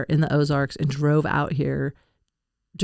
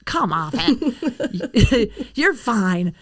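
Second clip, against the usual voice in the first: mocking brush-off, laughing; dismissive; 4 semitones higher, much slower than usual. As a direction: scoff it off with a laugh. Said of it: "The people that ask for healing are like".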